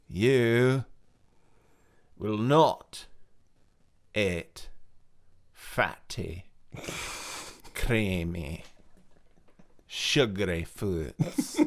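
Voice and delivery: slow, word-by-word command with long pauses; mock solemn command. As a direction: spell out each word like a hypnotist. Mock hypnotic command in shout out.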